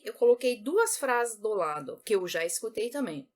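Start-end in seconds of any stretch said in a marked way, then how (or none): none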